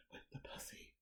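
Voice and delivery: whispers the punchline. Real, not acted. Co-host teasing a friend, in a sly whisper.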